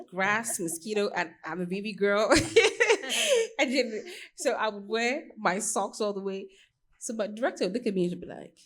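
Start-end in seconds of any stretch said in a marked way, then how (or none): 1.1-6.5 laughing loud and high through the words; giggling as you tell the mishap. Real, not acted. Telling the interviewer about mosquitoes, laughing loudly.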